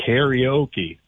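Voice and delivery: karaoke said slowly as "KAIR-ee-OH-kee"; American pronunciation. Shown as a demonstration. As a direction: spell out karaoke by ear, slow "KAIR-ee-OH-kee". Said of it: "most Americans would say".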